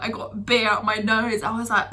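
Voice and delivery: usual speaking voice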